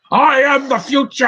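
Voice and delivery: slow, high, loud hammed-up proclamation; mock melodramatic declaration and gasp; Bill Nye voice. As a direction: overact a grand villain line, then choke. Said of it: "the Bill Nye voice".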